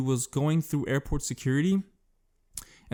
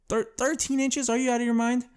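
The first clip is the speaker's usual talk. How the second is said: incredulous; 9 semitones higher than usual. Real, not acted. Disbelief at thirteen inch claim.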